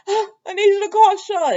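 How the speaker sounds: high, loud imitated urgent plea; mock panic. Jan in labor begging Marlena for Sean, mock panic. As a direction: cry out in hammed-up distress.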